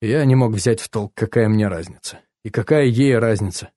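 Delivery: plain reading voice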